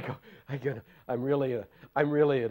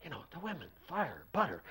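retold whisper; 5 semitones higher, 6 dB quieter, faster than usual. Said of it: "Jayatirtha whispers to me".

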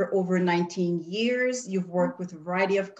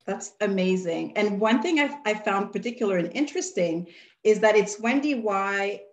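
usual speaking voice

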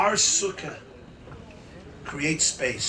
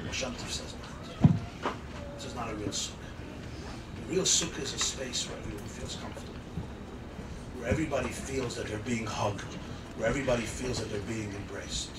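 soft and tender; 4 semitones lower, 5 dB quieter, faster than usual. Acted. Soft turn after climax.

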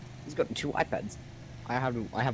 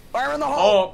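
much higher, much louder warning yell; shout; 11 dB louder than usual. Real, not acted. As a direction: bellow the warning before the blast. Throwing dynamite, warning teammates.

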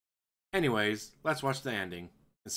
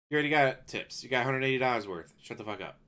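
ordinary talk, nothing marked

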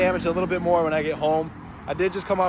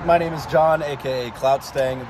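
normal talking voice

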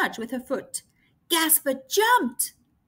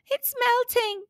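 high, alarmed cry; mock shriek, high; 11 semitones higher than usual. Gasper alarmed at the melting iceberg, high cry. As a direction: cry out in alarm as the ice melts. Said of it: "shrieked Gasper".

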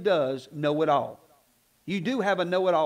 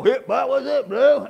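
mock casual; 3 semitones higher, 6 dB louder than usual. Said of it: "go in there and be all".

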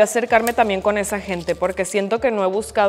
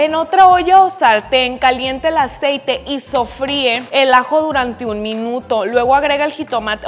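louder, read out like instructions; recipe reading; 3 semitones higher, 5 dB louder than usual. Acted. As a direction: read the recipe step aloud. (leyendo la receta en voz alta)

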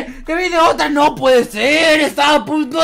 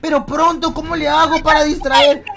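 ordinary talk

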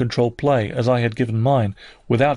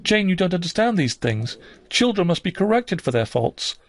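higher-pitched, self-justifying aunt; unsettled aunt; 7 semitones higher than usual. A narrator's reading. Aunt justifying correction of children to Jane, high and defensive. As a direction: explain defensively in a raised pitch.

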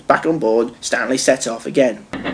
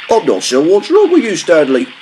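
higher, firmer driver character; engine driver voice; 5 semitones higher, 4 dB louder than usual. A narrator's reading. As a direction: puzzle it out as the driver. Driver speaks to Stanley.

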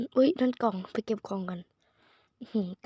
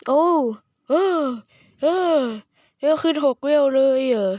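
excited shout; 3 semitones higher, 6 dB louder than usual. Real, not acted. Gamer shouting to viewers about a six-level jump, thrilled. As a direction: cry out in delight at the jump.

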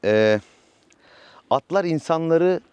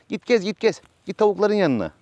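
shooing the horse; 6 semitones higher than usual. Real, not acted.

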